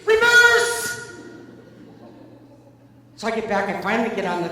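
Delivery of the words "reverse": full-volume high yell of one word; imitated screaming. Storyteller as Dad screaming signs at a nervous driver, high shout. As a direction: scream it out like Dad reading a sign. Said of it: "he's screaming".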